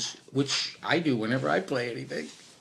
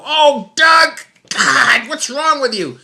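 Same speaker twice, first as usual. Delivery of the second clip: much higher and louder, exasperated at yourself; mock self-scolding; 13 dB louder, slower than usual. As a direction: yell at yourself after missing the shot. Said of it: "they go, they go".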